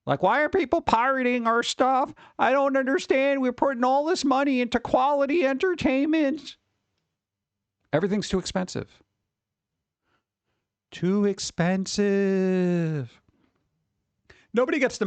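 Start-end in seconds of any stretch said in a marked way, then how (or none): 0.1-6.6 whiny exec. Retold or imitated. Mocking streaming execs. 10.9-13.1 drawn out. Pretend. Drawn out mock echo.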